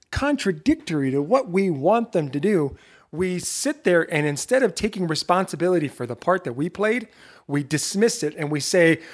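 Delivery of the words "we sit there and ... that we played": ordinary delivery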